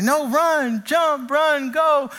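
high excited cheering; root for the hero on screen; mock-excited dad yelling at the movie.

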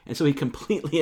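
usual speaking voice

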